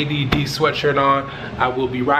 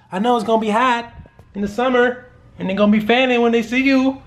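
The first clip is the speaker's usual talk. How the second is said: ordinary talk, nothing marked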